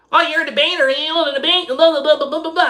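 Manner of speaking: high taunting mock voice; mocking the girl. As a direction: sneer it like a snotty know-it-all. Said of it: "And they're like".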